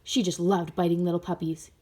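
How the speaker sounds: usual speaking voice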